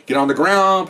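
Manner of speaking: high, loud order; police shout. Retold or imitated. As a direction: shout it like a cop on a raid. Officers storming in, ordering suspects.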